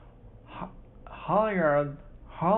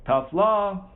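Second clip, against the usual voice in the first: loud, higher mocking call across the water; 6 semitones higher, 6 dB louder than usual. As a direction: call it out to the other boat. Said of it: "called Jasper Green".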